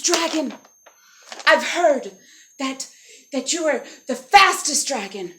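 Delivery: loud, emphatic praise with pauses; flattering princess. Acted. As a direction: build up the flattery, punching the key word. Elizabeth flatters dragon with challenge.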